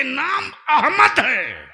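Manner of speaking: high, drawn-out shout; loud pulpit proclamation; proclaiming cry. Acted. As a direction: call out the name at full force, stretching it. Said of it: "पुकार पड़ी".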